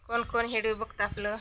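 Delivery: ordinary talk, nothing marked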